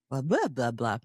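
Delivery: ordinary talk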